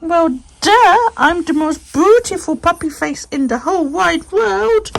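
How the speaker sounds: the dog's imagined cheeky reply; voicing the dog. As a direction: speak for the dog, smugly. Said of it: "It's like".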